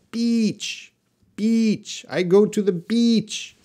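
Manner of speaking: "beach" with a long ee, pitched high; long vowel. Shown as a demonstration. Teacher warns to be careful with it, long high ee in "beach". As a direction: stretch the ee in "beach", voice lifted high. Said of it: "It's a long sound".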